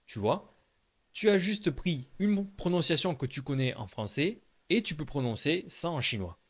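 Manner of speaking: usual speaking voice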